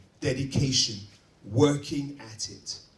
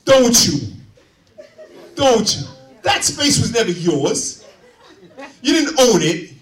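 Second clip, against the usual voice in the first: mock outraged shout; 7 semitones higher, 11 dB louder than usual. Preacher mocking drivers' possessiveness over road space, exaggerated outraged shouting. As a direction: shout it in exaggerated outrage, stretching the last words.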